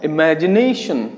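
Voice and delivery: Imagination slowly, leaning on MA and NA; stressed syllables drawn out. Shown as a demonstration. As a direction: stretch the second and fourth syllables of imagination. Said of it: "इन पे जोर ज्यादा आता है". (Imagination में 'मै' और 'ने' पर ज़ोर, धीरे-धीरे)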